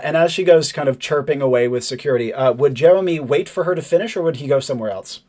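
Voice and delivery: ordinary delivery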